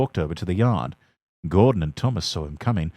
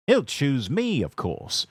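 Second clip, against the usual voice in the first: boasting; 6 semitones higher, slower than usual. A narrator's reading. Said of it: "boasted James the Red Engine".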